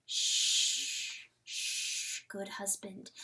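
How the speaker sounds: soft unvoiced shushing, trailing to a hush; hushing him gently; hushing whisper. A narrator's reading. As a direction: soothe a tired husband in a whisper. Wife hushing her mewing husband.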